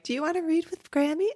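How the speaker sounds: high, hurried imagined quote; imagined ghost grandma. Acted. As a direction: lightly play a ghost coaxing a child. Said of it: "How does that conversation go down?".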